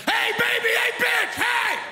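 high, loud catcalling yell; yelling bikers. Acted. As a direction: holler crude catcalls across a street. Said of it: "eight bikers yelling".

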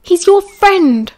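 high, loud, drawn-out protest; indignant outburst. A narrator's reading. Sirius protests to Marlene about his friend, indignant.

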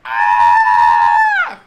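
very loud imitated peacock screech; long, blasting bird shriek; peacock scream. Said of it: "It was a peacock just going".

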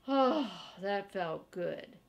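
usual speaking voice

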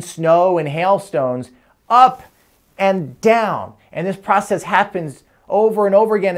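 lively picture-book reading; reading to a toddler. Acted. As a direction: read it like a bedtime book. Reads a children's book aloud.

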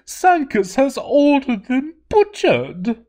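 high, slow desperate plea; pleading cry. Acted. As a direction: beg for help, near tears. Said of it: "she cried".

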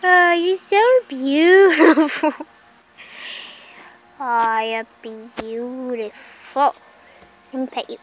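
soft adoring coo. Real, not acted. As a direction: coo over the frog. Cooing to frog.

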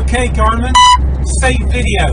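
loud, clipped order to the dashcam; loud voice command. Real, not acted. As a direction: bark the command over road noise.

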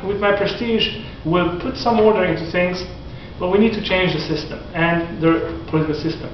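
normal talking voice

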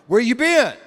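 loud, accusing demand, words punched; like a parent who already knows the answer; accusing parent bellowing where you been; stern accusing. Acted. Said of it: "you gotta hear this tone".

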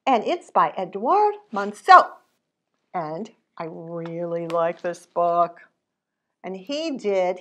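usual speaking voice

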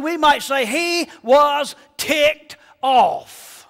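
loud, high, shouting preacher building up; preaching at full force. Acted. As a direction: thunder it out from the pulpit.

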